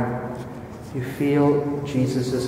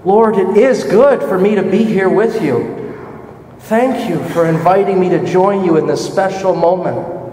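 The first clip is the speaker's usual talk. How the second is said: louder, higher grateful exclamation; joyful echo; 5 semitones higher, 5 dB louder than usual. Acted. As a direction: thank him with overflowing joy. Echoing Peter's joyful words.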